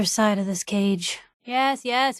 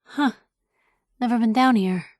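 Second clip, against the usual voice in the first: usual speaking voice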